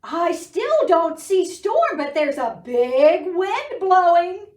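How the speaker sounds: high, loud lookout call. Acted. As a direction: call down from the window. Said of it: "Duck called down again".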